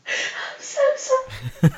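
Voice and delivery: high, slow, tearful giggly squeak; real helpless laughter. Real, not acted. Co-host apologising for losing it, helplessly laughing. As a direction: squeeze it out softly through laughter.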